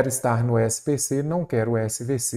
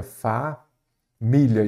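drawn out; much slower than usual. Shown as a demonstration. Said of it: "teacher drawing out the word".

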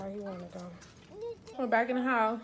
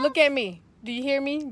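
loud stern demand; angry dressing down; 5 semitones higher, 13 dB louder than usual. Real, not acted. Mother scolds loudly.

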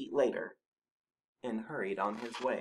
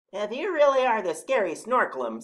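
high, louder animal challenge; Badger's voice; 12 semitones higher, 9 dB louder than usual. A narrator's reading. Badger doubting the Snorklum, high loud character voice. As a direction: question the monster's boast pointedly. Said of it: "said Badger".

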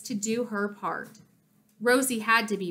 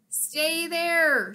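higher-pitched scratchy speaker voice; 5 semitones higher than usual. A narrator's reading. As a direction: rasp it out like a crackly gadget. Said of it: "said a scratchy voice".

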